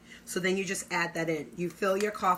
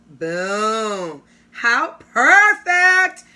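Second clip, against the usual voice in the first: excited; 3 semitones higher, 12 dB louder, much slower than usual. Real, not acted. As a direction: gush with delight, drawn out. Gushing over finished sandwich.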